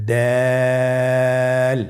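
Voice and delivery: দাল held long for three alif lengths; দাল sung out long and steady; drawn-out letter. Shown as a demonstration. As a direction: stretch দাল slowly and evenly, like a Quran teacher. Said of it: "তিন আলিফ টেনে পড়ব".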